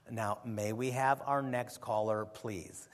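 low, slow, soft radio host sign-off; imitating Camping. Mimicking the radio host moving to the next caller, slow and low. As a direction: mimic the old host's dry call for the next caller. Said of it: "the way he, he said".

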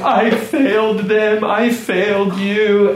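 high, slow, broken wail of a crying wife; retold sobbing. Husband retelling his weeping wife, high and slow. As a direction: let the voice crack high and drag out each word. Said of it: "she's been bawling".

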